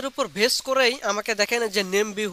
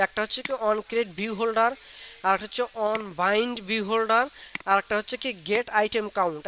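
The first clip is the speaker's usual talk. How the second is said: slow dictation; slower than usual. Shown as a demonstration. Teacher repeats the method names.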